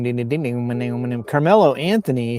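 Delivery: usual speaking voice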